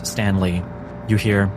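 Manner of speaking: ordinary delivery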